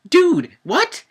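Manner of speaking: high, loud dude what; incredulous outburst. Real, not acted. Vlogger baffled at Oscar's line, high and loud. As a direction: throw up your hands in disbelief.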